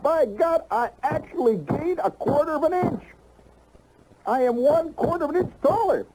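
high, triumphant and amazed; high-pitched elated boast; thrilled amazement. Retold or imitated. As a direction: crow over the quarter inch. Ecstatic discovery of gaining height.

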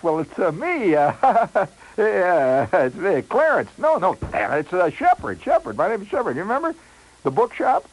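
high, loud, nervous stammering with laughs; over-eager, hammed-up embarrassment on the phone; flustered caller. Flustered caller reminds woman who he is.

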